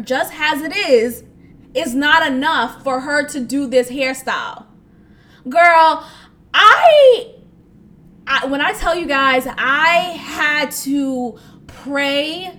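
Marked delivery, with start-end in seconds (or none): 5.5-7.3 loud, high burst of disbelief; exasperated outburst. Real, not acted. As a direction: blurt it out, half laughing.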